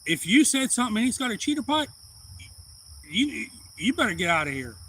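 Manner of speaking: mother warning the insulter to leave; retold urgent warning. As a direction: rush a frightened warning. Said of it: "So she goes".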